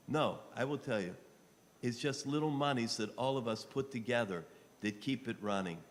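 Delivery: ordinary delivery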